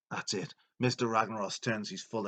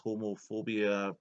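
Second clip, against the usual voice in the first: ordinary talk, nothing marked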